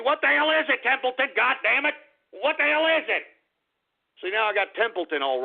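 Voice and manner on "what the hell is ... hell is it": higher, irate demand; fed-up outburst in a raised pitch; exasperated yelling. Real, not acted. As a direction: holler at a pestering pet. Snapping at crying Templeton.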